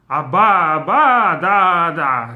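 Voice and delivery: "Abba, Abba, da, da" beaten out loud and drawn out; rhythmic stress demo. Shown as a demonstration. Teacher sounding out spaced stresses for the class, rhythmic stress demo. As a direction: hammer the stressed beats of "Abba, Abba, da, da", slowly. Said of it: "something like".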